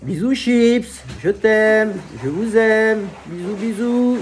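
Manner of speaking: high, loud and drawn-out affectionate calls; blowing loud kisses to wife and dog; loving call-out. Real, not acted. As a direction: stretch out the kisses, call them high and slow. Sending love to wife and dog.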